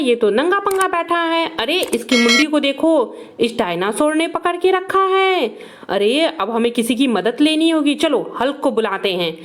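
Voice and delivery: usual speaking voice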